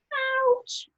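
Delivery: very high tiny-mouse "Ouch!"; little mouse squeak. Acted. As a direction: squeak like a mouse under a zebra. Mouse sat on by zebra.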